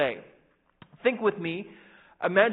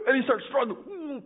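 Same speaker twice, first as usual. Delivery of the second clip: struggling; 7 semitones higher, slower than usual. Retold or imitated. Drowning boy acted.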